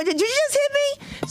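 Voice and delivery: very high incredulous question; shocked disbelief. Acted. Comic reacting to boyfriend's blow, hammed-up shocked disbelief. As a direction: shoot the pitch way up in mock astonishment. Acted shock at being hit.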